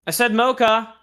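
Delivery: loud, high, insistent reply; retold exasperated repeat. Retold irritated repeat to coworkers.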